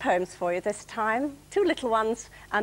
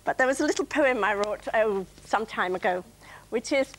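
ordinary talk

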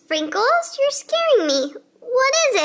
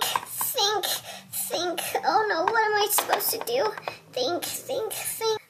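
ordinary talk

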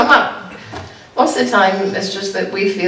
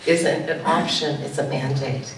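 quiet firm; 5 semitones lower, 9 dB quieter than usual. Retold or imitated. Pastor restates mandate to Pastor Mary.

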